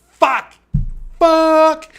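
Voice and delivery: loud, high, drawn-out curse; reenacted shout of dismay; exasperated yell. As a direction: yell it out in mock panic. Shouting after runaway dog in story.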